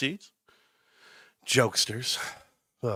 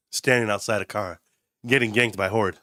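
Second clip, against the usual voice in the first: ordinary talk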